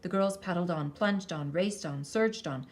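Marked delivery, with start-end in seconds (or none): none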